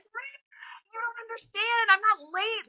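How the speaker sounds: a frantic plea of innocence to the trainer; pleading protest. Retold or imitated. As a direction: plead your case to Rip, voice climbing high.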